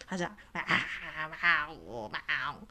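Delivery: low moaning cat howl; imitated low muffled yowl. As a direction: groan it like a tomcat howling. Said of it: "在外面走动闷嚎".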